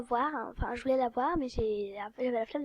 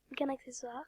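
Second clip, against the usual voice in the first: whisper; 10 dB quieter than usual. Real, not acted. Whispers while checking items.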